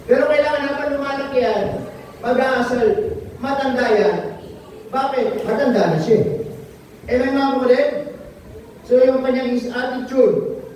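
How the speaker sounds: ordinary talk, nothing marked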